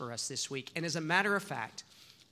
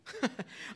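short high laugh; 5 dB quieter than usual. Real, not acted. Laughing at own childhood hurt.

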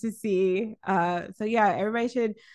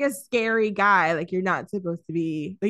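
normal talking voice

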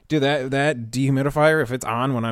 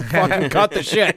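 ordinary talk

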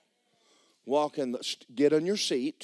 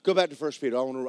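usual speaking voice

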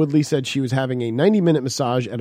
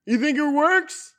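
high loud slow angry yell; road rage yelling; 12 semitones higher, 4 dB louder than usual. Retold or imitated. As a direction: yell at the honking driver. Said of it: "yells at people who are honking".

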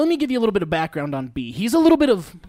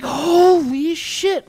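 slow, high, stretched-out disbelief; mock stunned; drawn-out shock; 9 semitones higher, 6 dB louder, much slower than usual. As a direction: let it hang in awe.

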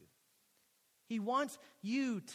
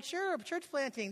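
high, drawn-out breezy "sure"; acting out naive enthusiasm; eager agreement; 7 semitones higher, slower than usual. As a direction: agree keenly, stretching the words. Retells couple's eager agreement.

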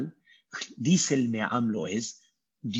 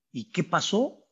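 usual speaking voice

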